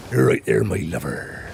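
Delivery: imitating a Bristol dad who sounds like a pirate; hammed-up West Country pirate lilt; West Country accent. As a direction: put on a broad Bristol accent. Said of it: "He's got that accent".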